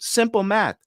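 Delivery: "Simple math" pitched high and condescending, like a smug dealer; mocking salesman. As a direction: say "Simple math" in a high, patronising sing-song. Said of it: "and he goes".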